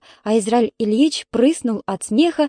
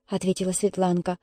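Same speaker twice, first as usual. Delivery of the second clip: usual speaking voice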